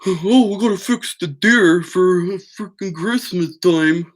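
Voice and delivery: put-on whiny father impression; mocking father. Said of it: "and my father was like".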